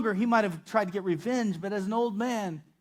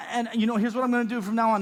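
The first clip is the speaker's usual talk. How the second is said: quick, higher-pitched rattling through a plan; rattled-off speech; 5 semitones higher, 3 dB louder, much faster than usual. Retold or imitated. Son promising his father what he'll do, rattling it off. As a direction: rattle it off in a rush. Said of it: "he's starting to rattle it off".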